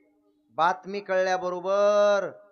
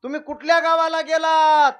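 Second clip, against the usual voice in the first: high, loud, drawn-out wail; wailing daughter; 11 semitones higher, 14 dB louder than usual. Retold or imitated. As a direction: cry out for the lost father. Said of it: "ती भागीरथी रडायला लागली".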